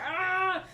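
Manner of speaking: short high yelp of alarm; startled cry. Retold or imitated. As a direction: let out a sudden alarmed cry. Mercury thermometer in mouth.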